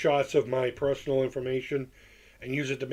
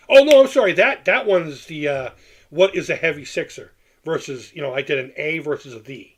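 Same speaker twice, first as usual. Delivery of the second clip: normal talking voice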